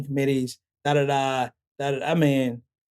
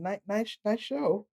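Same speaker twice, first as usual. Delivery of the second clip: mock passerby; 7 semitones higher, 6 dB quieter, slower than usual. Passersby mocking sympathy.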